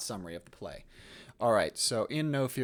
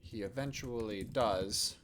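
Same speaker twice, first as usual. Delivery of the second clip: slow, halting dictation with long pauses; composing aloud; 7 dB quieter, slower than usual. Real, not acted. Teacher drafting a play summary for students, slow dictation. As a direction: dictate softly to yourself, phrase by phrase.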